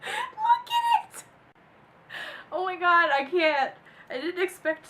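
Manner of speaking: high, loud crying-laugh, drawn out; helpless laughter. Real, not acted. Cracking up at the ET caption, helpless laughter. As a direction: howl with laughter till it squeaks.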